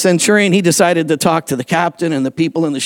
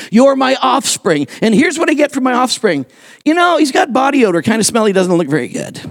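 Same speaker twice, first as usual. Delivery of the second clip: higher-pitched mock grievance; acted indignant complaint; 6 semitones higher than usual. Paul quoting his church's insults, indignant. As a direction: play up the complaint, pitched high then dropping.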